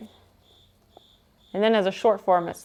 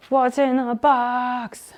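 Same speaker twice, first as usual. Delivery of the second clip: movie line quote; slower than usual. Retold or imitated.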